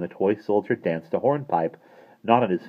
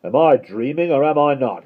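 higher, loud muttering to himself; bewildered postman; 7 semitones higher, 7 dB louder than usual. A narrator's reading. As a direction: puzzle aloud in a raised voice. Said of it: "he muttered".

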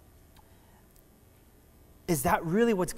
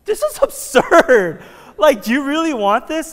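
high, loud laughing incredulity; incredulous; 9 semitones higher, 11 dB louder than usual. Retold or imitated. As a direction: scoff in amused disbelief. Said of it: "I'm just like".

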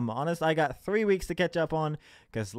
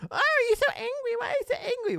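very high put-on hippie voice; mocking hippie. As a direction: squeal it in mock concern at someone angry. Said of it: "Or they'd be like".

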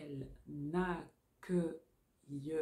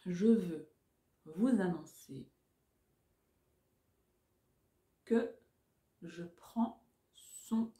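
dictating. Shown as a demonstration. Dictation slowed into chunks.

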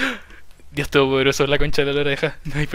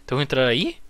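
ordinary talk, nothing marked

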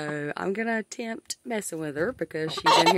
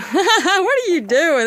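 high loud slow sing-song; cooing to hen; 10 semitones higher, 7 dB louder, slower than usual. Real, not acted. Talking to her golden girl hen, high slow cooing.

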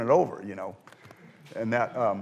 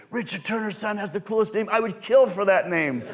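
envious gushing; 7 semitones higher than usual. Retold or imitated. Imagined envious magicians gush.

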